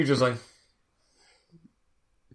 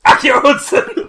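high, loud, slow; loud mock spell; spell incantation; 9 semitones higher, 7 dB louder than usual. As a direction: cast it dramatically. Summoning spell cast.

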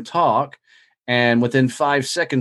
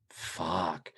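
deflated curse; 6 semitones lower, 15 dB quieter than usual. Retold or imitated. Realizing he's not scalable.

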